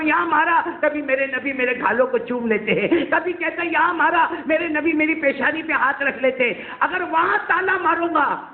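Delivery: rising emotion. Acted. Emotional climax of the story.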